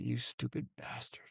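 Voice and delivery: very soft, under the breath; hushed, rueful aside to himself; muttered self-reproach. Retold or imitated. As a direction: quietly curse yourself as a private thought. Silent self-reproach after his blunder.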